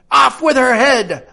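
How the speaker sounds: the queen ordering Alice's execution at full shout. Acted. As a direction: scream the order with all your might. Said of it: "shouted at the top of her voice".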